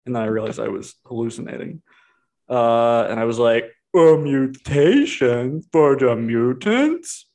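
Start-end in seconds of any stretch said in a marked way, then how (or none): 3.9-7.3 very slow, stretched-out mock wonder; hammed-up slow questioning; mock amazement. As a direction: drag every word out in exaggerated disbelief. Mocking own reaction to the reveal.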